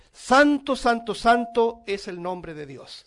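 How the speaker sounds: a thundering triple santo from the pulpit; proclaimed holy. Real, not acted. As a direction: declare santo with full preacher force. Proclaiming God's holiness.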